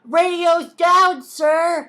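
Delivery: high, loud, slow frantic report; panicked soldier. Acted. Young soldier reporting to the captain in high, loud panic. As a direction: yell it like a scared rookie.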